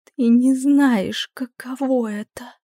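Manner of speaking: high, slow and desperate; despairing cry to a friend; distraught. A narrator's reading. Terrified succubus answers her friend.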